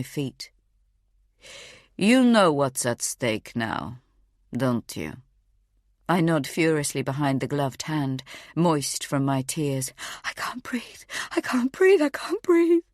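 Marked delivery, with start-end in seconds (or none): 2.0-5.2 low, slow, icy warning; a low measured threat to a terrified mother; cold menacing threat. Acted. Captor's final threat. 10.2-11.0 breathless voiceless whisper; gagged mother whispering for air; panicked whisper. Acted. 11.3-12.8 high, loud, gasping plea; the fear breaks into a cry; gagged mother crying out for air; panicked cry. Acted.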